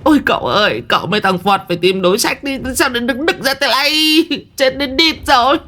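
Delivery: put-on high, fast whining old shaman; tearful panic. A narrator's reading. Old shaman begging the young master for a plan. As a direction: whimper and babble in fright. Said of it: "khóc không thành tiếng".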